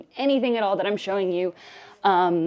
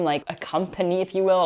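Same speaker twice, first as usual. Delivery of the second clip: normal talking voice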